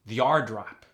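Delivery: normal talking voice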